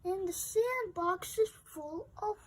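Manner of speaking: plain reading voice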